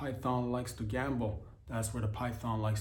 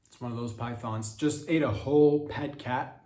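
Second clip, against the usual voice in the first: ordinary talk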